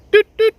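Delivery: loud, high beeping bite alarm; imitated bite alarm beeps.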